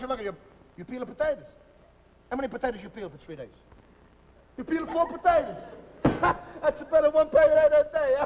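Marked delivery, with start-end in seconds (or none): 4.6-5.6 high, incredulous mock scolding. Mocking a slow potato peeler. 6.0-8.3 slow words broken by laughter; laughing. Real, not acted. Laughing at the boy's one potato a day.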